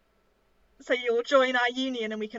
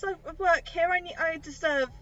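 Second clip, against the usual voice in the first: ordinary talk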